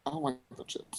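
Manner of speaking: near-whisper with a laugh; whispered repeat. Real, not acted. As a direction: breathe it out, amused. Repeating dislike of chips.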